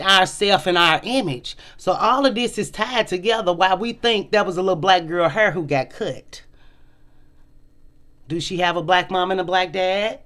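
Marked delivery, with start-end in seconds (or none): none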